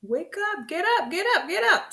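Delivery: high singsong urging; waking a child. Pretend. Parent rousing a child out of bed, high repeated urging. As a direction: call it high and insistent, rising in urgency.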